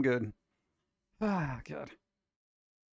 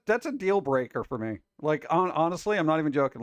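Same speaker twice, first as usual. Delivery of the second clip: ordinary talk, nothing marked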